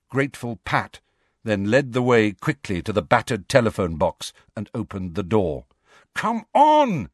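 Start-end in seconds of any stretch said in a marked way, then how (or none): 6.1-7.1 high, loud urging call. A narrator's reading. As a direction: urge hesitant companions on, loud and high. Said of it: "he urged the others".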